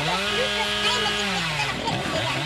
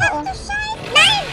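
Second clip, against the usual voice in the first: panicked shout; 12 semitones higher, 7 dB louder, slower than usual. Real, not acted.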